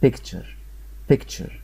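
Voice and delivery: "picture" with its ending blended into one cher. Shown as a demonstration. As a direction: softly model "picture", ending as a single syllable. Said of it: "قلناها على بعضها تشر".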